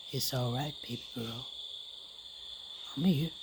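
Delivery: low, soft father's comfort; reassuring daddy. Acted. Daddy to his shaking little girl, reassuring. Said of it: "and he said".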